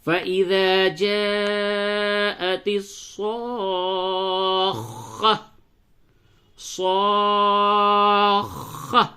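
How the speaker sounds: very slow, drawn-out chanted recitation; Quran recitation. Acted. Teacher recites the verse slowly in class. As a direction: chant each word slowly, holding the vowels.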